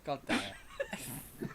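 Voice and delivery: slow, soft annoyed curse; muttered curse. Real, not acted. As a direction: grumble it under your breath. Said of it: "frustrated aside".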